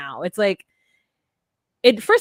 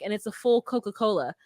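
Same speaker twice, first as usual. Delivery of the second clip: ordinary delivery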